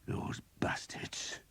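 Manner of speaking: slow, bitter whisper; vengeful. A narrator's reading. Skeet cursing the late-arriving men-at-arms, a slow bitter whisper. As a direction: hiss it slowly with resentment. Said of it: "he said vengefully".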